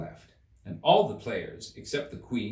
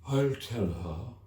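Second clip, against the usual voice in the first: deep hollow tone; slower than usual. A narrator's reading. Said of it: "in a deep, hollow tone".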